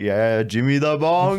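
teasing nickname. Retold or imitated. Owner greeting the worker by nickname, slow teasing drawl. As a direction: stretch the nickname mockingly. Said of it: "he'd be like".

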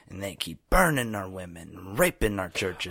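impression of an overwrought TV ranter; freaking-out news pundit. Quoting a one-sided news guy to a co-host, freaking out. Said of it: "he's freaking out, and he goes".

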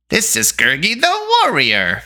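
high, slow creature voice; Gurgi boasting boldly. A narrator's reading. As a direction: boast in a high beastly voice, words stretched. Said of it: "Gurgi boldly cried".

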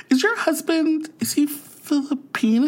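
high-pitched, hesitant imitation of a nosy stranger; tentative stranger. Stranger asking wife about her husband at McDonald's, tentative. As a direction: ask it gingerly, voice lifted high. Stranger's cautious retold question.

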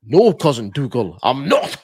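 slow, put-on mock Scottish movie accent; hammed-up film Scottish accent; quoting the film in a put-on Scottish accent. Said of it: "movie quote, accent talked about".

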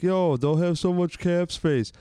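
lightly voiced know-it-all fan, brushing it off; mocking fan voice. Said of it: "I have to hear from all these fans saying".

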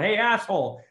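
lightly acted, irritated call-out; retold annoyance. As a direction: imitate calling out a clumsy buddy. Snaps at buddy who hit him.

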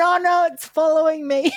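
high laughing cry of dismay; laughing mock dismay. Laughing at her sober doctor, comic horror.

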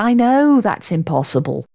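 plain reading voice

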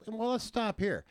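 high, lightly voiced bartender; robot bartender voice. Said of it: "the robot bartender says".

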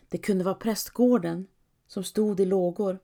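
ordinary delivery, nothing marked

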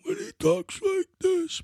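a light, high put-on Godfather mob boss rasp; Vito Corleone voice. Narrator imitates the Godfather's famous voice, lightly put-on. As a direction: slip into the Don briefly as an impression. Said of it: "because Sam the Plumber talked like that".

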